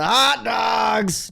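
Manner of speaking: long drawn-out ballpark hot dog cry; loud, high, stretched vendor call; vendor yell. Retold or imitated. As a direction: holler it like a hot dog seller working the stands. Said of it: "they yell that".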